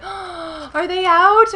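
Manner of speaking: high, slow panicked question; panicked gasp. Retold or imitated. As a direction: relive the panic of them being sold out. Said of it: "and I was like".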